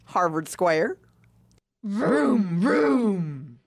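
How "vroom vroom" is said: car engine noise. Acted. Imitating a car driving.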